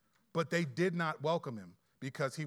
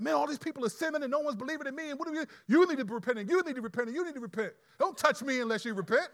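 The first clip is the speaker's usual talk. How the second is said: higher, fast, scolding rant; mock judgmental preacher; 8 semitones higher, 6 dB louder, faster than usual. Preacher mocks a Jesus who scolds sinners, in a fast, higher rant. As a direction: ham up a preachy, condemning Jesus. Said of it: "He wasn't like".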